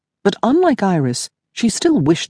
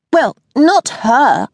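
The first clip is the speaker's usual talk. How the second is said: put-on high, slow blunt girl; a tactless young girl; petulant girl; 10 semitones higher, 4 dB louder, slower than usual. Daisy's voice, higher.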